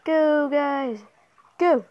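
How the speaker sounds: high, loud call to teammates; urging shout. Real, not acted. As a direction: shout them onward. Shouting at players in game.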